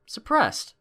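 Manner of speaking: plain reading voice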